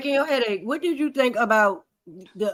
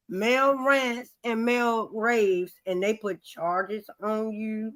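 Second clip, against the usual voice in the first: very slow, deliberate questioning; host grills guest slowly, mocking; mock quoting; 2 semitones lower, 4 dB quieter, much slower than usual. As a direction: spell it out slowly like a skeptic.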